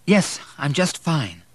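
hiding sobs. A narrator's reading. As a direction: hold back the sobs while insisting. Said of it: "trying unsuccessfully to hide the sobbing".

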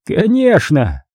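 high, mocking "Конечно"; cardinal's cheerful yes. A narrator's reading. Cardinal answers the prisoner, high and mocking. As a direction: toss it off gleefully.

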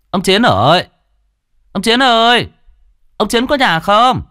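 high, loud call across the yard. Acted. Servant boy calling the old man from outside the house, loud and high. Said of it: "Thằng Tèo chạy vào sân gọi".

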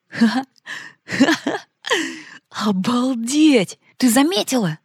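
laughing, high and loud; bursting out laughing with delight. A narrator's reading. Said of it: "рассмеялся Алёша". (смеясь)

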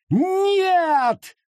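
very high shrieked no. Acted. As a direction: scream in terror. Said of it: "завизжал Гаррет".

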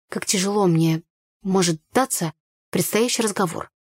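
plain reading voice

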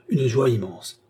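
ordinary delivery, nothing marked

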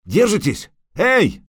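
high, urgent calling; shout from above. A narrator's reading. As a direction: call down to people in danger. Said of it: "крикнул сверху Лютик".

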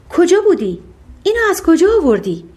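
mildly excited, happily inquisitive; delighted. A narrator's reading. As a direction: lift the pitch in happy curiosity. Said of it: "با دیدن سکه بسیار خوشحال شد".

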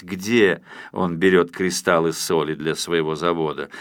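plain reading voice

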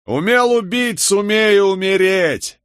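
high, slow jeering bark; barked shout. A narrator's reading. Someone in the crowd barks at the condemned man. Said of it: "рявкнул кто-то в толпе".

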